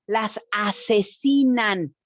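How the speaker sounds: las asesinan said slow, each syllable weighed; stressing asesinan. Shown as a demonstration. Correcting the audience: slow, heavy asesinan. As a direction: lean hard on asesinan, slow and deliberate. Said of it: "no, no se dice mueren".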